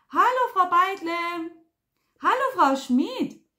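high, drawn-out hollered hello; loud, surprised greeting. A narrator's reading. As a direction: call out in exaggerated surprise so nobody can miss it. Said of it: "grüßte er so laut und überrascht". (laut und überrascht grüßend)

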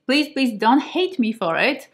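usual speaking voice

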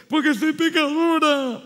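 put-on high, loud, slow sobbing girl; crying child. Girl answering the pastor, crying and loud. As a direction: wail it out like a little girl in tears. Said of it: "Pasa ahí llorando".

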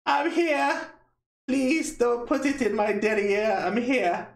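high, squeaky mock viewer voice pleading to be heard; mock viewer pleading from the chat; imitating the viewer. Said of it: "He's like".

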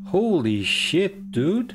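high, slow amazed exclamation; stunned after a scare. Real, not acted. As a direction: breathe it out in shock. Tras el susto. (asombro tras el susto)

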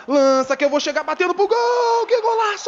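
rising excited shout into goal; explosive goal celebration. Real, not acted. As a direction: yell it as the ball goes in. Scoring a goal, screaming. (grito de gol explosivo)

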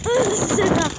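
high, startled outbursts; spooked exclamations. Real, not acted. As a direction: react loudly to something creepy. Spooked during ghost hunt.

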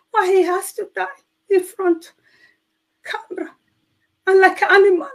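high, pleading retold cry of anguish; anguished crying questions. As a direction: ask God through tears, voice lifted high. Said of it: "I started crying and thinking".

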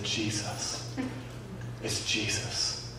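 hushed, slow whisper of the name; whispered answer to the healed stranger, slow and reverent. Retold or imitated. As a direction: breathe it softly in awe. Answering what power healed him.

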